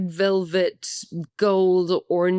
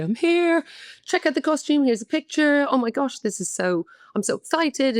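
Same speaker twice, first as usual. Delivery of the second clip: excited chatter; 7 semitones higher than usual. Retold or imitated. Retold phone call to late mum.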